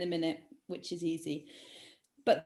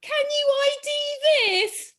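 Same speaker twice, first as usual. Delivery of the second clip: loud, high theatrical wail; dramatic riddle voice; 7 dB louder than usual. Acted. Quizmaster repeating the riddle for friends, over-dramatic put-on voice. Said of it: "do it in that kind of voice".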